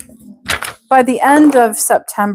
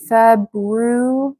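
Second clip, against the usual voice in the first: ordinary delivery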